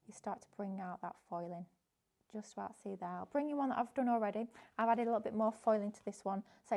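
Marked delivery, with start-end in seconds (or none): none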